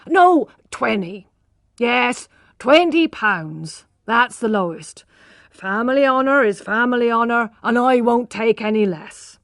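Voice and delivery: loud, eager haggling, price dropping each time; loud, excited haggling over a price; agitated bargaining. A narrator's reading. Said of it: "cried Lady d'Urberfield".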